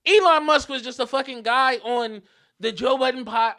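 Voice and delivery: retold heated yelling in protest; screaming back. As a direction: argue loudly across the street. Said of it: "I'm screaming back at him".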